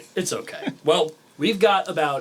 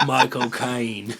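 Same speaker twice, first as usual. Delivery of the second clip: Michael Caine accent; much slower than usual. Shown as a demonstration. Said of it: "say my cocaine in Michael Caine's accent".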